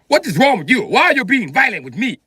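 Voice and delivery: high, loud, hammed-up outrage; over-emphatic words. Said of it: "You putting emphasis on words".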